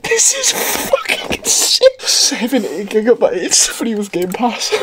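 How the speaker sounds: high, slow words squeezed through laughter; incredulous giggles. Real, not acted. Player reading seventy gigabytes aloud, incredulous giggles. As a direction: stretch it out while giggling helplessly. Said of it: "I've just got the giggles".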